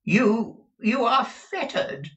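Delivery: high, loud, fearful gasp of it; trembling fear. Acted. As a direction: stammer it out in terror.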